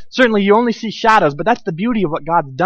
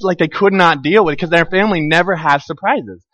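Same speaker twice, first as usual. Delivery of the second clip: ordinary talk, nothing marked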